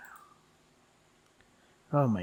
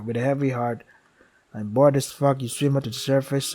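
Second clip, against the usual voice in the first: steady storyteller reading of the game text; game story narration; 6 dB louder than usual. Acted. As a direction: narrate it like a dark fable.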